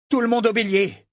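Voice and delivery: cri aigu et fort d'un capitaine; ordre crié. A narrator's reading. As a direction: rassemble ses hommes d'une voix forte. Captain orders his men. (Brachen crie l'ordre à l'équipage)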